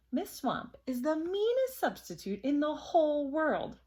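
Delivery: hint of a dramatic schoolyard warning; ominous big kid. A narrator's reading. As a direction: lightly play a kid spreading a scary warning. Big kid warns the others.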